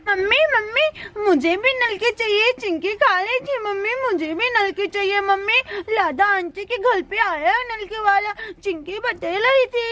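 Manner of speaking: put-on childish lisping whine; insistent little child. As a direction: nag mother for a treat like a small child.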